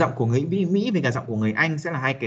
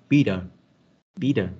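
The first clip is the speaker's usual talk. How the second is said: American bitter. Shown as a demonstration. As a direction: say "bitter" like "bidder", American flap. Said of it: "thay vì chúng ta đọc chữ T, chúng ta sẽ đọc sang chữ D". (đọc "bitter" giọng Mỹ, T thành D)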